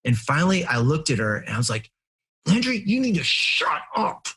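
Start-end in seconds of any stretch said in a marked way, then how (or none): 2.5-4.3 re-enacted angry snap, pitched high; intense, mean scolding of a daughter. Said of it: "like, intense and mean".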